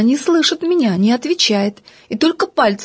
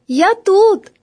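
put-on high, louder small girl's answer; little girl piping up; 9 semitones higher, 3 dB louder than usual. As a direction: pipe up from hiding like a small girl. Голос Феньки из-под дивана. (звонкий высокий голосок девочки)

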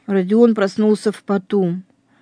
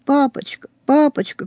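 put-on a higher voice for the boy crying out to his father; the boy begging his father to leave; child's cry; 7 semitones higher than usual. A narrator's reading. Детский крик. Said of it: "кричит Родя".

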